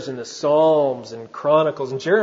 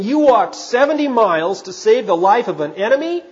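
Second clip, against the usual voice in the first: higher-pitched, incredulous question; astonished cry; 5 semitones higher, 4 dB louder than usual. Retold or imitated. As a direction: question it in amazement, raised pitch. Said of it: "cried Washington".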